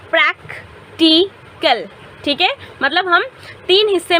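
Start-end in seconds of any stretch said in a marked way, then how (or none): 0.1-1.9 practical said slowly as prac-ti-cal, three separate parts; broken into syllables. Shown as a demonstration. Teacher breaking the word apart for students, slow syllables. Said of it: "इसको तुम तोड़ो".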